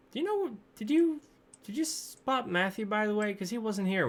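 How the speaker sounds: high-pitched character voice; Patty asking around. Acted. Patty asking visitors about Matthew, high-voiced query. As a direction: pitch the questions high, hesitantly restarting. Game master plays Patty.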